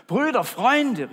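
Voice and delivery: high, loud, slow call for help. Acted. Man opens windows, calling loudly to friends. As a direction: call across to the neighbours. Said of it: "rief er".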